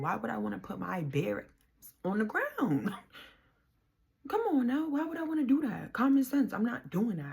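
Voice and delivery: high, loud incredulous protest; indignant refusal. Retold or imitated. Woman refuses officer's order to sit, loud indignant protest. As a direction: protest the absurd order, pitch shooting up.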